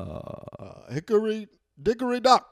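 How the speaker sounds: halting "uh", then loud, high punch; hammed-up mash-up impression; Andrew Dice Obama. Obama doing a crude comic's nursery rhymes, loud impression. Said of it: "Andrew Dice Obama".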